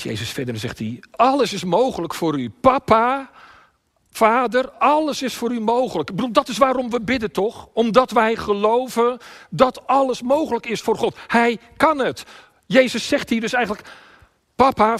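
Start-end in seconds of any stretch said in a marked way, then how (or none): 1.1-6.1 high, loud plea to Papa; impassioned prayer. Acted. Preacher voices Jesus calling on his Father, impassioned. 6.1-13.9 high, loud sermon at full force; impassioned preaching. Real, not acted. Preacher tells congregation why we pray, impassioned.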